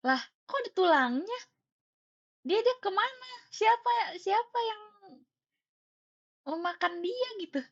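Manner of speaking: high, loud wailing questions; retold tearful dismay. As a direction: wail in disbelief, acting out the upset. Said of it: "Itu aku nangis karena".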